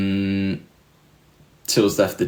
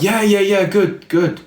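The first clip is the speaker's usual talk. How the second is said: imitating a nervous, higher reply; flustered fan; 9 semitones higher than usual. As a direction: rattle it off like you're caught out.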